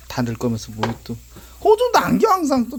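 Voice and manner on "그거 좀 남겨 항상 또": shrill nagging call; sudden high call. Real, not acted. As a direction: call out sharply to leave some. Scolding someone dumping everything. (높게 소리치는 잔소리)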